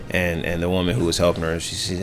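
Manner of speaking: ordinary talk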